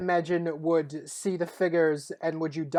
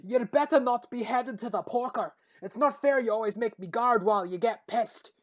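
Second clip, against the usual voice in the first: higher, calling out to the departing men; calling after; 5 semitones higher than usual. Acted. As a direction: call after them, aggrieved. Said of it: "Haas is calling after them".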